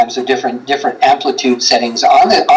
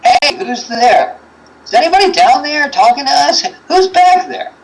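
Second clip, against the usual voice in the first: calling out; 12 semitones higher than usual. Retold or imitated. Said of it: "we called out some questions".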